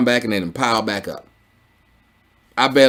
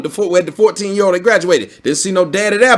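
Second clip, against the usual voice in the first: ordinary talk, nothing marked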